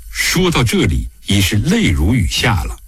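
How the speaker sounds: plain reading voice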